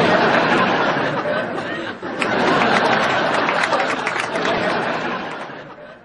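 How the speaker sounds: high breathy burst of laughter; big laugh. Real, not acted. Co-host laughing hard at the patient's answer. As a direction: crack up at the punchline.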